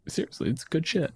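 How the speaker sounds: normal talking voice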